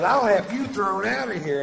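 high, loud mock-indignant lady; mock shrill threat. Woman threatening to have him thrown out, high mock squeal. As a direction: squeal it like an outraged patron. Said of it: "She goes".

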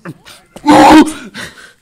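shrieking burst of laughter; loud high laugh. Real, not acted. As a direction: crack up loudly. Big laugh after loss.

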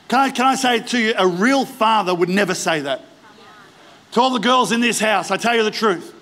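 ordinary delivery

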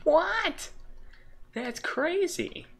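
sudden squeaky high shout of disbelief; can't believe the loot count; stunned disbelief. Real, not acted. Gamer stunned by nine diamonds, shouting in disbelief.

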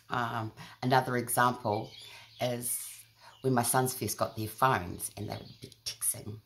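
ordinary talk

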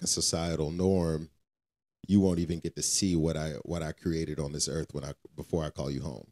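ordinary talk

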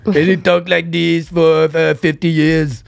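put-on slow, thick German-accented English; Arnold Schwarzenegger. Holding up a movie star as example, German accent. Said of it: "qui s'appelle Arnold Schwarzenegger ... avec un accent allemand".

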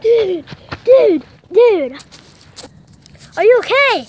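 panicked yelling, loud and high; alarmed shout. Real, not acted. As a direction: shout in panic for a hurt friend. Friend just fell hurt.

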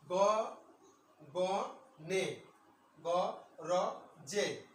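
গ, গ, নে, গ, র, জে said as separate syllables with pauses. Shown as a demonstration. Teacher splitting গগনে গরজে into syllables for students. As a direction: spell out গগনে গরজে syllable by syllable, pausing after each. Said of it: "একসাথে উচ্চারণ করতে হয়".

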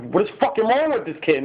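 high-pitched mock yell, no real volume; mock scream. Vlogger screaming at own old video, mock yell. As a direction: re-enact yelling at yourself in exasperation. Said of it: "I was screaming at myself".

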